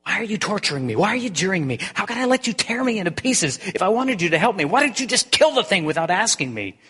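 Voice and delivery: high, strained and pleading, rising to loud; a ghost railing at the angel who hurts him; anguished accusation. Acted. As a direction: hurl the questions at him in distress.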